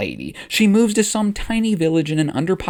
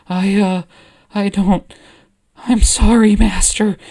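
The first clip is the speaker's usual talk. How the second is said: nervous stammer; 5 semitones higher, 6 dB louder than usual. Acted. Ahsoka caught by Obi-Wan.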